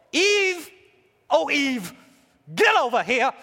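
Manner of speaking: loud, high calling out; hammed-up holler for someone to come; mock summons. Mock God calling out for Eve.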